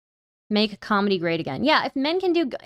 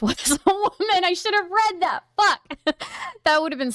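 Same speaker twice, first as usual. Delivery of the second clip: giggly and much higher, laughing through the words; 9 semitones higher than usual. Real, not acted. Streamer to chat, laughing at a missed comeback. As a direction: crack up at your own missed joke.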